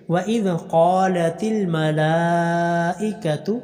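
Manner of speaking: slow drawn-out tajweed chant; slow tarteel recitation; Quran recitation. Shown as a demonstration. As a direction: recite each vowel long and measured. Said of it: "قاف کو لمبا کرنا ہے".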